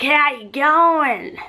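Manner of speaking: loud, slow, high bogan Australian greeting; bogan Australian accent. Retold or imitated. Bogan greeting a passer-by in broad Australian accent. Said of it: "real bogan Australians, and they're like".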